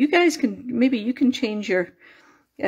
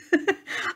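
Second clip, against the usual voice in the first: embarrassed giggle; 9 semitones higher, 4 dB quieter than usual. Real, not acted. Laughs at own muddled words.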